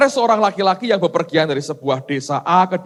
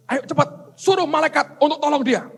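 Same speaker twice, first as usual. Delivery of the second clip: urgent God voice; 10 semitones higher than usual. Acted. God urgently orders angels.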